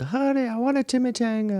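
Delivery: Dustin Hoffman impression. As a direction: whine it like a needy husband. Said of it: "Dustin Hoffman is in fucking Meet the Fockers".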